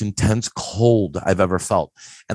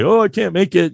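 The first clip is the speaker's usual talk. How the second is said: mock regret; 8 semitones higher, 5 dB louder than usual. Excuse to friends, acted out.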